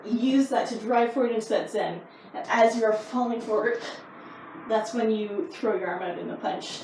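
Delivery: ordinary talk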